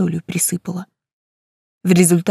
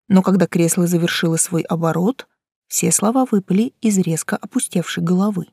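usual speaking voice

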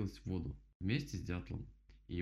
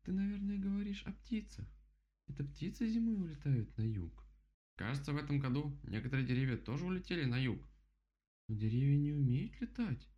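high playful character voice; storybook character voice; 6 semitones higher than usual. Acted. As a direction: voice the animals brightly. Bear and rabbit dialogue.

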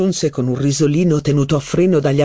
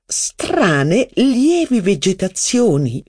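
ordinary delivery

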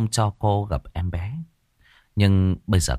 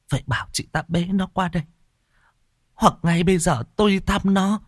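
higher-voiced, urgent mistress; anxious young mother; 11 semitones higher, faster than usual. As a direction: press the maid to bring the baby. Narrator voices the mother Nhài.

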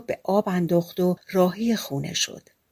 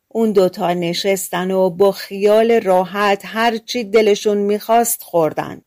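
plain reading voice

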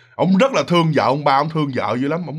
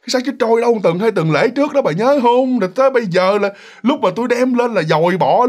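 higher, insistent husband arguing back; defensive protest; 6 semitones higher than usual. Retold or imitated. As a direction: protest that it was only a skull. Chồng cuống quýt cãi lại. (giọng chồng phân bua, cãi lại)

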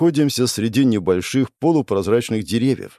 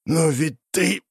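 raised, strangled, rasping reply; choked wheeze; 6 semitones higher, slower than usual. A narrator's reading. Hero held by the throat, choked wheezing reply. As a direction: force the words out while being choked. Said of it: "прохрипел я в ответ".

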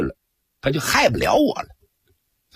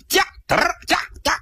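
high, ringing call to urge the horses on; faster than usual. Acted. Coachman shouting at his horses to go faster. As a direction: snap the reins and cry out to the team.

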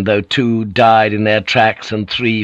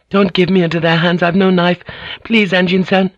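high pleading, near tears; desperate pleading; 9 semitones higher than usual. Acted. Mariko begging Blackthorn not to hand her over, pleading. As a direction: beg for your life.

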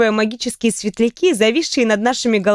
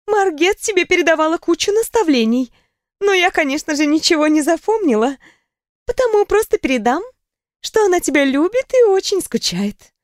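playful, laughing friend telling gossip; lively young woman; 7 semitones higher than usual. A narrator's reading. Riona passing message.

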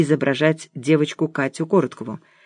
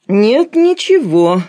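slow, drawn-out, higher denial; smiling; 6 semitones higher, 5 dB louder, much slower than usual. A narrator's reading. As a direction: wave it off with a smile. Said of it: "улыбнулся я". (с улыбкой, протяжно)

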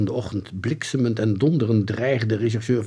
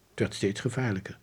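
ordinary delivery

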